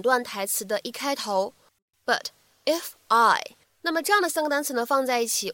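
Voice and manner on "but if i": "But if I" slowly, a pause after "But"; unlinked "But if I"; words kept apart. Shown as a demonstration. Separate words before linked demo.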